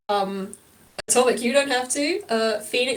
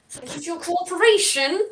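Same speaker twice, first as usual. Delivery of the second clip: through gritted teeth; 7 semitones higher than usual. Acted. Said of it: "they say with gritted teeth".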